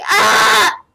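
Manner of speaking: loud high startled yell; loud yelp. Retold or imitated. [name] acting out his reaction in the story, loud startled yell. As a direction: blurt a sudden loud cry. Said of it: "I say".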